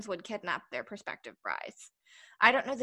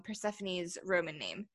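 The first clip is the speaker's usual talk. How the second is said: usual speaking voice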